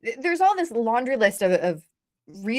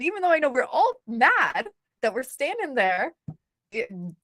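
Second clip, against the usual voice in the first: ordinary talk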